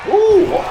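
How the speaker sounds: very high surprised ooh; a squeaky ooh of shock; little ooh sound. Real, not acted. Commentator reacting to the chair spot, high surprised ooh. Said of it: "that lovely little ooh sound".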